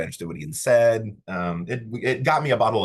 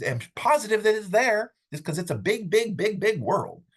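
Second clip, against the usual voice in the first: playful emphasis; 12 semitones higher than usual. Real, not acted. Playful exaggeration of big world.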